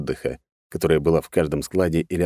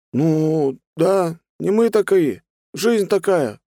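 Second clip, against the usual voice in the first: high, drawn-out defensive whine; thug making excuses; 12 semitones higher, 3 dB louder, much slower than usual. A narrator's reading. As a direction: justify yourself in a high voice. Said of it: "попытался оправдаться музыкант". (оправдываться высоким голосом)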